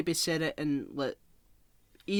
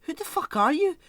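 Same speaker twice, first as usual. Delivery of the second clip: exasperated rant; 11 semitones higher, 8 dB louder than usual. Real, not acted. Ranting about an unknown character.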